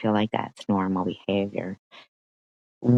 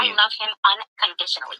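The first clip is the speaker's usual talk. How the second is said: mocking imitation; 9 semitones higher, much faster than usual. Streamer mimicking the caller's proud wife, high and mocking. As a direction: ham up her smug words, pitched way up.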